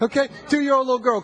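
ordinary talk, nothing marked

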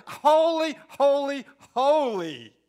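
shouted praise. Acted. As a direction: cry it out like angels calling. Angels crying out again.

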